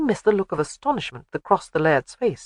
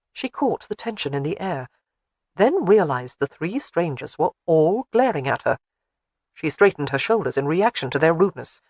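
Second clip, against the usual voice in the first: usual speaking voice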